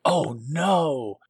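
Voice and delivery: drawn-out, swooping oh no; hammed-up comic-book dread; mock dismay. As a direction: play the dismay lightly. Characters find blood on helmet.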